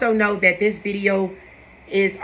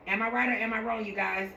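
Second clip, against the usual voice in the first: much softer second ask; softer repeat; 9 dB quieter than usual. Shown as a demonstration. Vlogger asking viewers again, much softer. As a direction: pull it back and ask again gently. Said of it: "repeats question softer".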